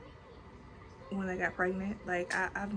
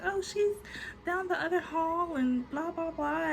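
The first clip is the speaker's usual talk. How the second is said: much higher brushing-off nurse voice; dismissive nurse; 10 semitones higher than usual. Retold or imitated. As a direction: breezily wave her off in a high voice. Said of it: "They're like".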